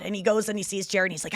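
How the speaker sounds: ordinary talk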